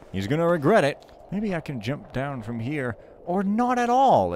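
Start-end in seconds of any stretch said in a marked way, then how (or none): 3.2-4.3 startled squeak. Real, not acted. Jump too high, backs off.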